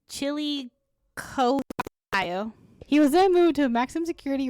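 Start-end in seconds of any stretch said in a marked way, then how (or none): 0.1-2.5 halting, drawn-out attempt at a hard place name; stumbling over an unfamiliar name; sounding out name. Real, not acted. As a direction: slowly feel your way through CHILLICOTHE, pausing before OHIO. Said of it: "oh, goddamn these names".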